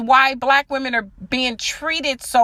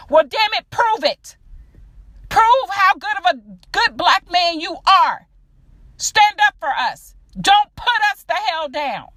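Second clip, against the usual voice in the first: higher, heated call-out; angry raised demand; 5 semitones higher than usual. Real, not acted.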